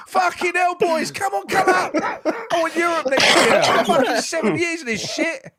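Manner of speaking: high, loud, laughing mock Bowen; imitating Bowen. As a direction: squeal it out as a frustrated Bowen, half laughing. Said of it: "And he's like".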